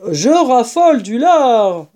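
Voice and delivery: a touch of dancing glee; excited badger. A narrator's reading. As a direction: cry out about the bacon with mild delight. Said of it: "s'écria Blaireau, dansant d'excitation".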